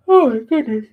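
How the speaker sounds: spoken through a yawn. Real, not acted. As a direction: let the yawn stretch the words. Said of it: "I did not mean to yawn".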